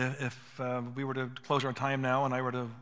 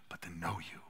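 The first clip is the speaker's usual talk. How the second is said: hushed whisper; 9 semitones lower, 9 dB quieter than usual. Real, not acted. Pastor telling men to know people, whispered. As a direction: drop to a whisper to land it. Sermon, tender point about knowing.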